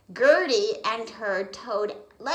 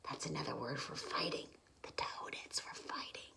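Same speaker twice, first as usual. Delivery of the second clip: whisper; 7 semitones lower, 16 dB quieter, faster than usual. Real, not acted. Aside explaining to child listener.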